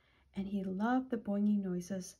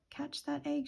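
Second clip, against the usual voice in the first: higher-pitched mild calling out; urgent call; 5 semitones higher than usual. A narrator's reading. Sheep calls after the rolling egg, mild urgent call. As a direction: call it out urgently, without real volume.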